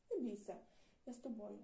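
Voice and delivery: plain reading voice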